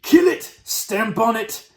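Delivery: high, loud shouts, slowing on the last order; shouting. A narrator's reading. Cooks chasing a mouse with their boots, shouting. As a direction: shout orders to the hunting mob. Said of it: "they were shouting".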